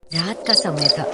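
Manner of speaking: ordinary delivery, nothing marked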